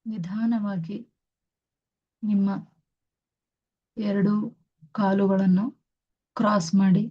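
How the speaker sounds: very slow, soothing meditation guidance; guided meditation. Acted. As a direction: ease them into stillness, word by word. Guides meditation with long pauses.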